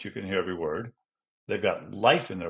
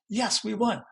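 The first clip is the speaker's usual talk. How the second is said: much higher, triumphant little cheer; excited student demo; 12 semitones higher than usual. Acted. Student acting out a win for class, excited. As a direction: celebrate the win in a high voice.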